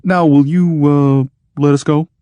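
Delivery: cautious. Acted. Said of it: "he added cautiously".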